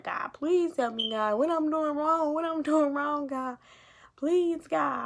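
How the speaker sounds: a mother reliving her labor pain, pleading aloud; retold screaming prayer. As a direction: cry out to God as if mid-labor. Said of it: "And I just started screaming".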